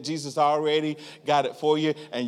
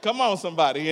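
ordinary talk, nothing marked

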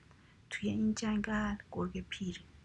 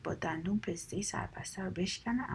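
plain reading voice

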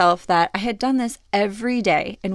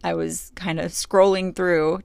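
ordinary delivery

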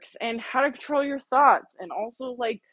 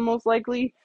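usual speaking voice

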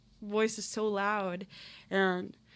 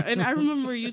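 words broken by a laugh; laughing while telling; 3 semitones higher, 6 dB louder, faster than usual. Real, not acted. To her friend, recalling his advice, laughing. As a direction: laugh through it, half moved.

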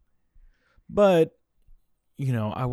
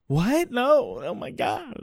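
put-on high, wailing kid protest; whiny child voice; 13 semitones higher, slower than usual. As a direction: squeal like an upset child. Child protesting leaving movie.

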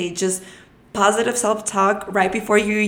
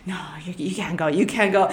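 panicked inner voice; 4 semitones lower, 4 dB quieter than usual. Retold or imitated. Nervous inner voice retold.

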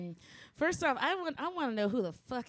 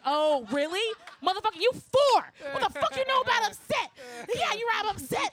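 much higher, louder exasperated rant; mock outrage; 11 semitones higher, 6 dB louder than usual. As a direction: blow up at the four-year-old in mock fury. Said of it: "And I'm like".

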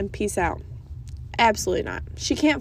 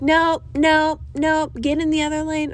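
higher, louder mock police bark; retold cop yell; 7 semitones higher, 4 dB louder than usual. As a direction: imitate a cop shouting her off. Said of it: "these cops are yelling at me".

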